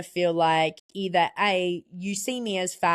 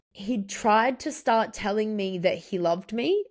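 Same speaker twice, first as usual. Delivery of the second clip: ordinary talk, nothing marked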